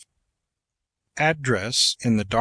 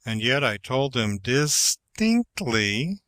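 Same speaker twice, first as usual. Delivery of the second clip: "distinctly" ends on a rising tune, said slowly; rise on distinctly. Shown as a demonstration. Teacher shows a rise on the last two syllables. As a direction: keep it level, then lift the last syllables of "distinctly". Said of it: "making no modulation until ... last two syllables".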